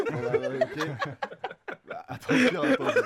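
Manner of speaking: talking through giggles; breathless laughing words. Real, not acted. Laughing at his own accent.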